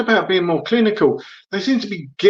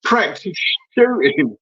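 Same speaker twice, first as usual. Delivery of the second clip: ordinary delivery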